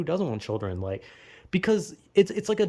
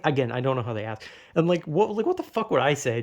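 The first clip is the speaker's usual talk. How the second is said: usual speaking voice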